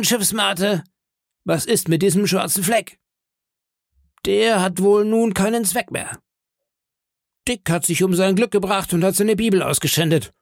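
a pirate chief savouring his win; Silver gloating. Acted. Silver mocks George and the crew, smug pirate voice.

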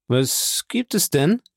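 slow, high question with a hint of metal; metallic voice. A narrator's reading. Stepmother answers Valentine's cry, slightly metallic and drawn out. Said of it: "die metallartig klingende Stimme".

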